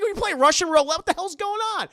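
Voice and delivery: can't believe this scene; incredulous outburst. Real, not acted. Streamer reacts to Russian roulette.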